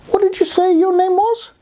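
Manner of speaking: high, sustained storybook character voice; high-pitched questioning animal character; inquisitive rabbit. Acted. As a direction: ask it high, like a curious rabbit. Rabbit questions the bubble wand.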